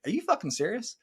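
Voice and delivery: high, incredulous outburst; exasperated disbelief. Retold or imitated. Husband venting at a nagging wife, high incredulous outburst. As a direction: throw up your hands, can't believe it.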